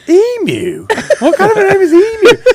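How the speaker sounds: high, loud, laughing disbelief; baffled. Retold or imitated. Layla hearing a fan's name, baffled high laugh. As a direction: squeak out a puzzled name in disbelief. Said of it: "Layla was standing there going".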